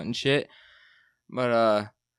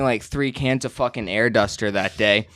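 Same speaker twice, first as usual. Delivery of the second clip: ordinary talk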